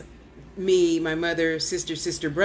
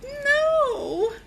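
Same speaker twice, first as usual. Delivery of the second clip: a high, squeaked refusal; high squeaky no. Retold or imitated. Refusing her gift in a high squeak. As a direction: squeak out a reluctant no. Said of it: "refusing to use her gift".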